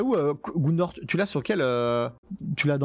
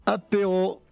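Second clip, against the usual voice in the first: excited shout; 7 semitones higher, slower than usual. Acted. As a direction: call out the drink time gleefully. Shouting apéro with laugh. (cri joyeux)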